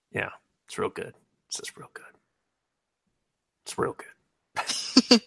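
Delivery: fading to a tearful whisper, then a squeak; mock choked-up. Fan praising the show, hammed-up choking back tears. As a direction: act as if too moved to speak.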